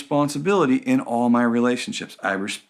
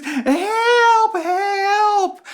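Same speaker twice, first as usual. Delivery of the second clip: very high wailing help; mock cry for help; 5 dB louder than usual. Former bad guy playing victim, crying for help. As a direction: wail it like a fake victim. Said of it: "the bad guy says".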